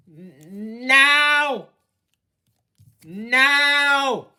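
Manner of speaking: shrieked high and loud, slowly dragged out; real out-of-control screaming at the passenger. Real, not acted. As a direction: lose it completely and scream. Driver screaming at stubborn passenger.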